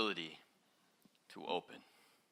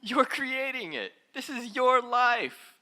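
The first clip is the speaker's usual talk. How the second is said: much higher, louder and slow; excited emphasis; 12 semitones higher, 10 dB louder, slower than usual. Real, not acted. Teacher stresses that you create your life, high, loud and slow. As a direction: lift it up, slow and big.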